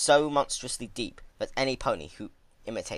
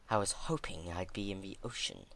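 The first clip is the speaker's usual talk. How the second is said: weary, quiet princess; 8 dB quieter than usual. Acted. Celestia weakly reviving.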